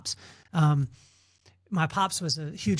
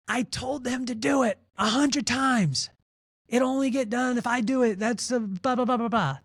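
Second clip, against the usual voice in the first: higher, fed-up complaining; mock a frustrated boss venting; exasperated manager; 7 semitones higher than usual. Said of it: "where they'll say".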